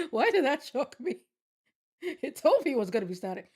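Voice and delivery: loud, high, still laughing; startled. Real, not acted. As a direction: shaken and laughing at the scare. Right after a jump scare.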